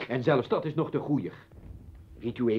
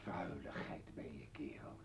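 whispered, much softer and lower; contemptuous whisper; 9 semitones lower, 19 dB quieter than usual. Acted. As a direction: breathe the insult in disgust. Sist zijn minachting. (minachtend fluisteren)

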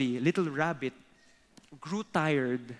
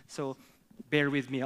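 normal talking voice

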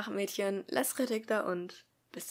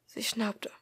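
usual speaking voice

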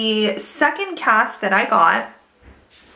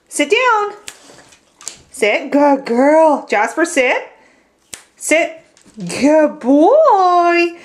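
dog commands and praise; 5 semitones higher, 4 dB louder, much slower than usual. Real, not acted. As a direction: command sit, then reward.

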